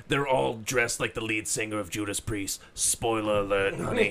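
low, soft camp dishing; Harvey Fierstein. Acted. Wrestler dishing on rivals' leather outfits, soft camp rasp. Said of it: "He's like".

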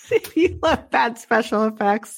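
giggly, slowed-down pitch; laughing through a sales pitch; laughing while talking. Real, not acted. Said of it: "laughing while pitching the film".

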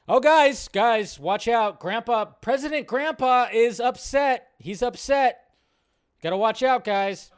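mock alarm. Mock warning about angry grandpa.

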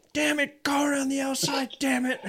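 put-on high, loud exasperated yell at a kid racer; frustrated dad yelling. A dad at the track yells at his kid to pass: exasperated high yell. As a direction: bark it like a fed-up dad at the trackside. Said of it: "a couple dads say".

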